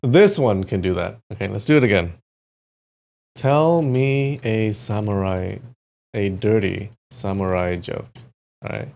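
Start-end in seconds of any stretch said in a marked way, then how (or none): 3.3-8.1 slow, deliberate prompt for voice input; slow dictation to a chatbot; dictating slowly. Real, not acted. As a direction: say each word slowly for the machine.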